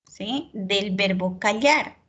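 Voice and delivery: usual speaking voice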